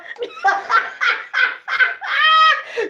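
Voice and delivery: big high shrieking laugh at her own joke; loud cackling laugh. Real, not acted. Laughing at her own comeback, big loud shrieking cackle.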